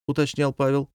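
ordinary delivery

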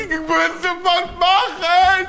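high, slow, loud panic; frantic shout. Retold or imitated. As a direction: cry out in despair. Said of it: "ich kann nicht mehr". (verzweifelt gebrüllt)

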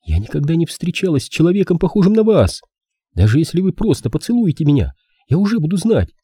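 put-on high-pitched yearning girl; young woman character. Girl asks the detective for a kiss, high pleading.

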